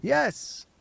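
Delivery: put-on high chorus of children; children answering. A narrator's reading. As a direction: chirp it like kids. Class answering together.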